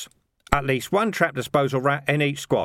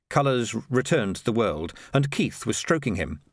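plain reading voice

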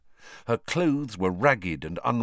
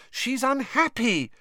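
exasperated. A narrator's reading.

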